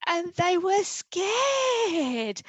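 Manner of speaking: high, loud and slow with fright; scared. A narrator's reading. Narrator acting the frightened mice, high and scared. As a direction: stretch out the fear.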